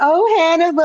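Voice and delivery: high gushing "Oh, Hannibal"; swooning fan. Retold or imitated. Repeated mock gushing.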